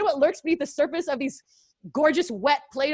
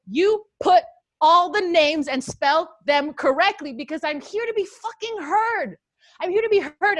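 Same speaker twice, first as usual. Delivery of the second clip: angry; 6 semitones higher, 4 dB louder than usual. Retold or imitated. Retold angry reply to promoter.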